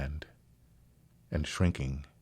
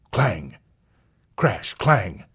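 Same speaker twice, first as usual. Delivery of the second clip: loud ringing clangs; 7 dB louder, much slower than usual. A narrator's reading. Narrator sounds the elevator doors, loud clangs. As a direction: strike each word like metal.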